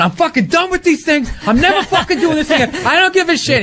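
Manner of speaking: high-pitched comic rant; flipping out. Retold or imitated. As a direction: re-enact an indignant tirade down the phone. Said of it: "flipping out".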